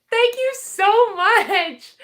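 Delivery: high, loud, drawn-out thanks; thrilled gratitude. Real, not acted. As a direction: gush with delight, stretching the words. Thanking the postcard sender.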